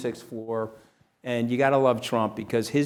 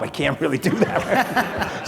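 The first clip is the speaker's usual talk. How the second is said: laughing; 6 semitones higher, 4 dB louder, slower than usual. Retold or imitated. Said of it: "The guy's like".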